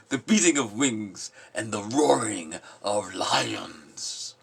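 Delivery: loud poem declaiming. Acted. Voicing the restless in the poem, loud declaiming. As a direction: call it out over the mountains. Resumes after cough.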